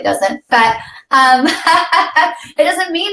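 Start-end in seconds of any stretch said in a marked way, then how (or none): 1.5-2.3 light laugh mid-sentence; a quick amused giggle; short laugh. Real, not acted. Teasing her co-host about grouchiness, laughing.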